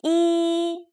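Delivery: "E" held high and level; first tone E. Shown as a demonstration. Teacher demonstrates first tone on E. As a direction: keep "E" high and flat. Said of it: "E, sounds like with four different tones".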